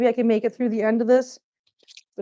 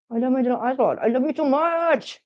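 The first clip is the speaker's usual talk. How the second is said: doting pet talk; 3 semitones higher than usual. Real, not acted. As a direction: gush adoringly, stretching the words out. Gushing love to pet cat.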